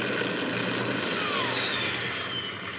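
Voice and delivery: high breathy squealing laugh; squeaky wheeze laugh. Real, not acted. Streamer wheezes high laughing about aliens.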